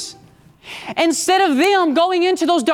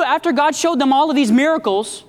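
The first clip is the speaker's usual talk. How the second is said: ordinary talk, nothing marked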